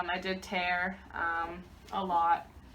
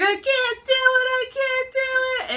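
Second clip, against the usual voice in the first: much higher, loud anguished cry, slowing on the repeat; desperate scream; 6 dB louder than usual. Retold or imitated. Mother retells screaming to the nurses mid-push, high and desperate. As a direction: cry out that you can't go on. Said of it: "And I screamed as loud as I could".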